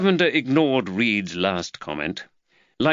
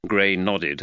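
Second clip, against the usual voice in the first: usual speaking voice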